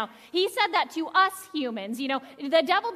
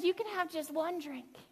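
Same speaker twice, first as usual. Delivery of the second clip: much softer, coaxing hush; a hushed, much softer temptation to drink; tempting murmur; 10 dB quieter than usual. Retold or imitated. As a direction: lean in close and tempt softly. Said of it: "it's that voice that says".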